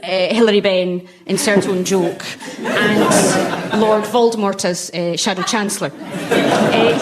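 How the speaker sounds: usual speaking voice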